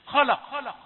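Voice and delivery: خلق with the lam made heavy like its neighbours. Shown as a demonstration. Teacher demonstrating the common mistake, a fully heavy خلق. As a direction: say خلق with a dark, emphatic lam. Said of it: "اللام ظلمت".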